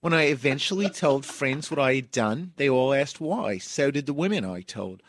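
put-on a loose, hammy Australian accent on the quote. Podcaster reads the Redditor's quote in a hammy Australian accent. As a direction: read the Redditor's words in a put-on Aussie voice. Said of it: "I'm gonna do this in an Australian accent".

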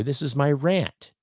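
ordinary talk, nothing marked

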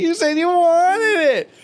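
high fake-crying pony whine; mock whining. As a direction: whimper like a spoiled pony. Continuing the whine bit.

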